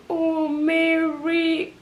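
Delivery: high, long drawn-out lament; acted sympathetic wail. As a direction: imitate a pitying sister, slow and high. Sister voiced reacting to the confession.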